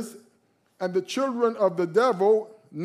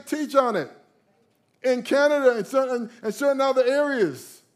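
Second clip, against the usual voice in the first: louder and higher, preaching at full force; preacher to congregation, fiery raised preaching; 7 semitones higher, 7 dB louder than usual. Acted. Pound the warning home from the pulpit.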